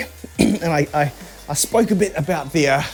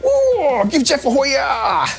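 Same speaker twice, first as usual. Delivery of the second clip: excited; 10 semitones higher, much slower than usual. Real, not acted. Excited shout-out to chat.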